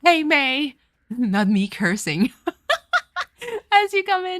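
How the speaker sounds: loud high laughing welcome; real bright laughing hello; laughing greeting. Real, not acted. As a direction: laugh while greeting the new viewer.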